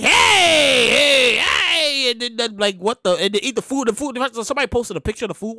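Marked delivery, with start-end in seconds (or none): 0.0-2.1 mock greeting. Acts out fancy gala greeting.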